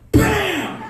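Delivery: loud, high "bam"; shouted door-slam sound; loud slam noise. Retold or imitated. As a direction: punch it like a slammed door.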